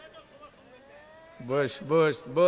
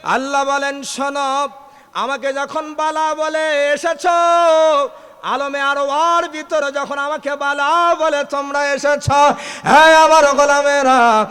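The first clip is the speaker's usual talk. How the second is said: high, loud chanted sermon cry; preaching at full force; 11 semitones higher, 9 dB louder than usual. Acted.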